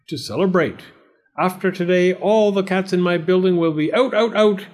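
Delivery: theatrical cat-banning landlord; triumphant landlord. Acted. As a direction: proclaim the cat ban, climbing to the end.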